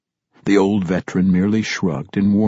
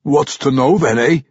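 put-on loud, raised gruff old dwarf; gruff old dwarf warrior; 5 semitones higher, 4 dB louder than usual. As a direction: bark it like a crusty veteran.

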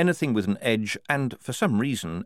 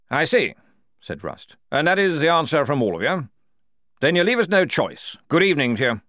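louder, stiff enemy officer; a proud officer ending the parley; officer Rust; 5 semitones higher, 5 dB louder than usual. Acted. Enemy officer Rust replies coldly.

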